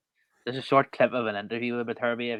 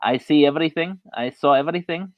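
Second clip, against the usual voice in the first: put-on high, loud Russian-accented quote of the boxer; 6 semitones higher, 7 dB louder than usual. Podcaster quoting the boxer's broken English, loud Russian accent. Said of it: "in a Russian accent".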